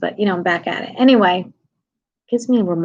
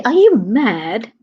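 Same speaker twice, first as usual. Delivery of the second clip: slow, drawn-out British "mad"; exaggerated British rising question; British accent; 2 semitones higher, 4 dB louder, much slower than usual. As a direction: imitate a Brit, stretching and swooping the question. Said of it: "You say".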